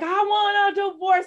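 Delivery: high, loud desperate cry; screamed quote. Retold or imitated. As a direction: yell it in the street. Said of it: "that's all I could get out".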